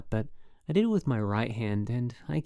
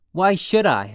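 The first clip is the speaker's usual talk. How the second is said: loud, much higher yell; practically yelling; 7 semitones higher, 12 dB louder than usual. A narrator's reading. Holden yelling back at his roommate, loud defiant yell. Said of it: "I was practically yelling".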